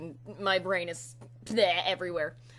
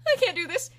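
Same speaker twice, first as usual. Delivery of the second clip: high, louder burst of giving up; panicked mock squeal; 10 semitones higher, 7 dB louder than usual. An auditioner telling viewers how they backed off, mock panicked. Retelling backing off from mic.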